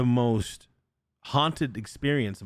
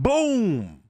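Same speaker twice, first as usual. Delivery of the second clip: high, booming imitation of a slammed door; loud boom; 10 semitones higher, 3 dB louder than usual. Creature slammed the basement door, loud boom. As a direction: hit it like a door crashing shut. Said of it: "and, and loud".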